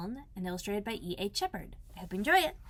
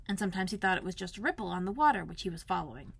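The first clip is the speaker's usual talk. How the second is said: ordinary delivery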